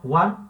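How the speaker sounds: Juan said as one syllable, hwan. Shown as a demonstration. Teacher giving the Spanish one-syllable Juan. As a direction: Juan in a single beat. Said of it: "uma coisa só".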